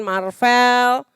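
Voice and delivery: ordinary delivery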